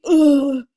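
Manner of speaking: loud "Ugh" like being hit in the stomach; exaggerated gut-punch grunt. Shown as a demonstration. Teacher showing the too-dramatic E, a loud grunt. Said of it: "so it's not gonna be".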